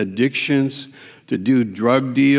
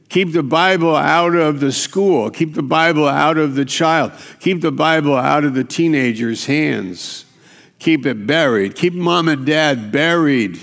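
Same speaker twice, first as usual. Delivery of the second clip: loud, driving sermon rhythm, pitch pushed up; fiery preaching; 4 semitones higher, 4 dB louder than usual. Acted. Preacher hammering the devil's commands to the church, full force. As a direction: preach it hard at the congregation.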